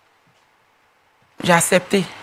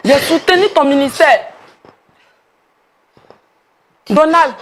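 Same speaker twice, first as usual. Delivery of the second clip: high, loud cry of betrayal; outraged shout; 7 semitones higher, 7 dB louder than usual. Real, not acted. Woman who backed his ministry, outraged shouting. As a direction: shout the grievance right at him. Betrayed supporter confronts pastor.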